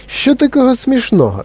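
put-on startled young girl asking; surprised girl. A narrator's reading. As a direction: ask it baffled, in a high girl's voice. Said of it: "здивувалася Віолета Борегард".

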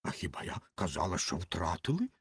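low, hushed retort; subdued low objection; objecting. A narrator's reading. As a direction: deny it softly and very low. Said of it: "заперечила Ракета".

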